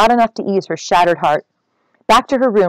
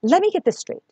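much higher, eager rush of questions; excited; 6 semitones higher than usual. Acted. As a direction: pitch leaps up with sudden excitement. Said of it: "She was starting to get very excited now".

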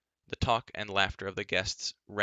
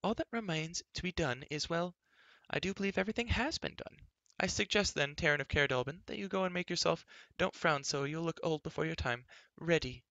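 high-voiced fussy queen, running on; Queen Teleria, chattering; 8 semitones higher than usual. A narrator's reading. Queen telling Taryn to get ready, fussy high chatter. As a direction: flit along in a high chatter, interrupting yourself.

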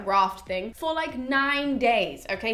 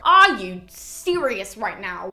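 exasperated disbelief; 3 semitones lower, 7 dB louder, much slower than usual. Acted. Odysseus smells the roast cattle.

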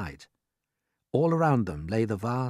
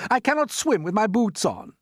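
loud, pitched-up panicky shout; shouting centipede; 13 semitones higher, 6 dB louder than usual. A narrator's reading. Centipede fears sinking in his boots, shouting. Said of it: "shouted the centipede".

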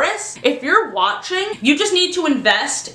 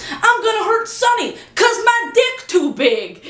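whiny character; 11 semitones higher than usual. Retold or imitated. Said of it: "It's like".

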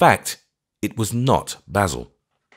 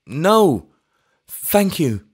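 higher, louder second no thank you; eager vicar repeating himself, high; repeated eager refusal; 10 semitones higher, 4 dB louder, much slower than usual. A narrator's reading. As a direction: insist again, overeager. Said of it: "with that hysterical eagerness".

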